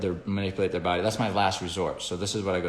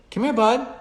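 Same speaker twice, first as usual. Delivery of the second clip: higher, louder friendly call to a dog; 6 dB louder than usual. Retold or imitated. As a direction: call the pup in, pitched up. Calling the dog Ollie.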